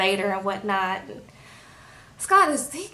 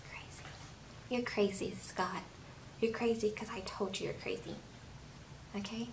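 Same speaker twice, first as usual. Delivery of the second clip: soft sly gaslighting whisper; taunting whisper; 15 dB quieter than usual. Retold or imitated. Manipulative girlfriend needling her boyfriend, whispered. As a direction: hush it like a private taunt. Voicing Lizzie gaslighting Scott.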